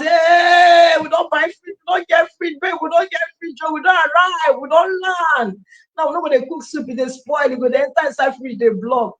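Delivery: high, loud joyful shouting with a friend; two friends celebrating a new fridge, shouting for joy; excited shouting. Retold or imitated. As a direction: shout the good news, over the top. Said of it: "with that excitement".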